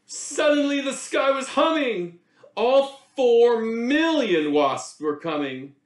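plain reading voice

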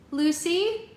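high, loud call into the dark; calling a lost friend in the dark woods. A narrator's reading. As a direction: call her name into the woods. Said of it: "she called into the dark woods".

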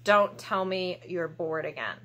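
ordinary talk, nothing marked

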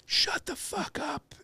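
very high, hushed imitation of a far-off yell; small, stretched-out yell, like an echo; echoing mock yell. His words echoing into the night, soft high mock yell. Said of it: "hear my words just echo".

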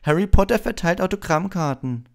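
high, loud mocking announcement; jeering town-crier shout; booming Malfoy. A narrator's reading. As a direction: proclaim it to the whole yard. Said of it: "dröhnte Malfoy in die Menge".

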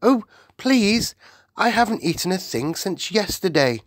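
put-on high, pleading little-girl voice; eager plea. A narrator's reading. Sophie begs for breakfast, eager high plea. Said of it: "Sophie qu-, cried".

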